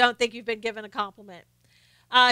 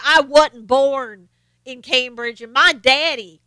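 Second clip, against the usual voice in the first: slow, emphatic Southern drawl; exaggerated Southern accent, words stretched; mock Southern accent; 5 dB louder, slower than usual. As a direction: imitate a politician's hammed-up Southern twang. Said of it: "get on a Southern accent".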